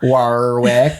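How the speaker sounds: put-on drawn-out joke Californian accent. Co-host joking over the character's name, mock Californian accent. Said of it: "That's my Californian's accent".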